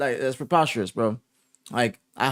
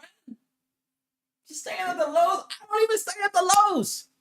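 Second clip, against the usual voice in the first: high, incredulous outrage, voice up in his head; exasperated at the team's hotel booking, high disbelieving outrage; outraged disbelief; 12 semitones higher, 4 dB quieter than usual. Real, not acted. Streamer ranting at the team, high outraged disbelief.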